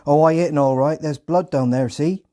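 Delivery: high, loud Hampshire farmer voice; loud rural Hampshire accent. Retold or imitated. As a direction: boast like a Hampshire farmhand. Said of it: "in a thick Hampshire accent".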